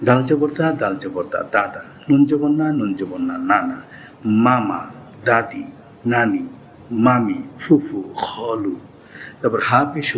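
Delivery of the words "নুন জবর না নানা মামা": ordinary talk, nothing marked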